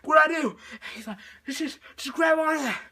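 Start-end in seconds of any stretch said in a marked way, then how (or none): 0.0-0.5 loud, high, urgent question; helpless mock panic; panicked cry. She asks him for help, panicked cry. 1.5-2.8 high, loud, stammered order; frantic coaching call; urgent shouting. Retold or imitated. He tells her to grab on, urgent shouting. Urgent instructions from drifting partner.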